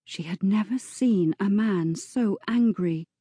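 normal talking voice

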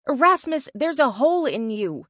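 loud, high alarm. A narrator's reading. Narrator sees the wounded friend's chest, loudly alarmed. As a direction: exclaim it in high-pitched alarm.

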